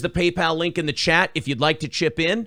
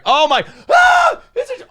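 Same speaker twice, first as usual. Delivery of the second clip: startled yelp; 7 dB louder than usual. Real, not acted. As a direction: jump and cry out in real surprise. Startled by something near him.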